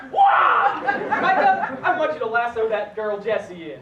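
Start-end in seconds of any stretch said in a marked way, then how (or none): 0.1-0.8 high, loud squawking wah; wah cry. Acted. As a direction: blurt out a wah. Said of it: "Start your sentences with "wah".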